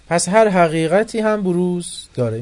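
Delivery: loud, high, slow declaration; loud climactic teaching line; emphatic. Real, not acted. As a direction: proclaim it as the key point. Lecturer climax.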